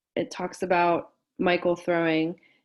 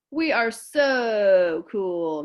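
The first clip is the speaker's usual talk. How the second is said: cool drawl; 2 semitones higher, 8 dB louder, much slower than usual. Acted. Boys boasting, cool voice.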